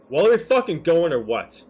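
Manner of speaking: loud, impatient yell; impatient shout. Acted. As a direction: bark it at the group, fed up. Character shouts impatiently at party.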